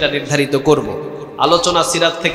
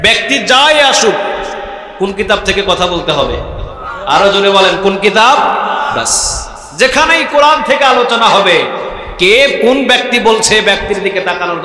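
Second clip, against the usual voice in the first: preaching; 4 semitones higher than usual. Acted. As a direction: drive the point home to the crowd. Preacher raised to crowd.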